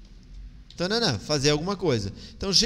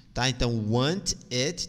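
usual speaking voice

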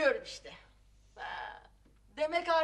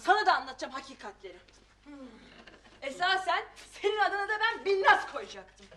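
normal talking voice

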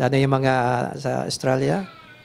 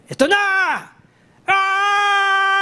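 high, loud war cry to the gods; battle cry; 4 dB louder, much slower than usual. Retold or imitated. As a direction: roar it like an army charging. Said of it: "armies will always shout to their gods".

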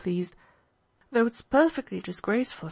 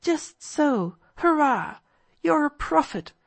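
put-on higher-pitched boyish exultation; delighted; 6 semitones higher, slower than usual. Kolya hails Alyosha as a prophet, delighted. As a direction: cheer him on, voice leaping up.

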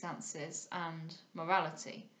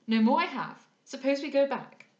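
loud, higher-pitched suggestion; eager cry; 6 semitones higher, 11 dB louder than usual. A narrator's reading. Maurice proposing to show the ladies the scenes, eager cry. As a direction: propose it eagerly and much louder. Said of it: "cried Maurice".

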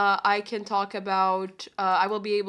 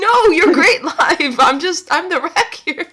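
laughing; 8 semitones higher, 10 dB louder than usual. Real, not acted. Laughing at herself live.

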